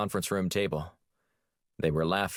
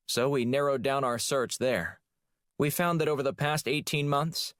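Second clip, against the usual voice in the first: higher, lightly voiced female agent; brisk, confident presenting to the boss; a hint of an eager analyst's voice; Shields briefing; 7 semitones higher than usual.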